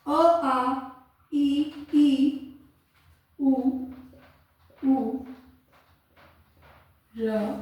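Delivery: each vowel said alone, slow, with long pauses; slow recitation. Shown as a demonstration. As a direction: recite the vowels one at a time. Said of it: "हमारी जो स्वर की वर्णमाला है". (स्वर धीरे-धीरे अलग-अलग बोलना)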